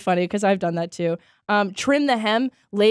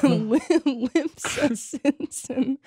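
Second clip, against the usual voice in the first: laughing; 5 semitones higher, much slower than usual. Real, not acted. Laughing, drawn-out nickname.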